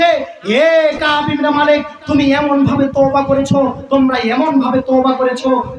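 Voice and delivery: high calling cry on the name, then easing; chanted sermon proclamation; impassioned preaching. Acted. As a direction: hail him with a drawn-out call. Voices the welcoming companion calling out.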